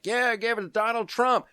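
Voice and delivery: high, put-on voice of the New Yorkers; mock crowd voice. A teller voicing the New York public, high and mocking. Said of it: "the people of New York are like".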